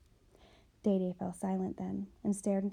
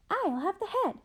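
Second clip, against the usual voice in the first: much higher, grabbing the head; the second sister squeals her claim; high greedy ghost sister; 11 semitones higher than usual. Acted. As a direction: pitch up, a sweet greedy claim. The other sister answers high.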